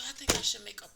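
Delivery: ordinary talk, nothing marked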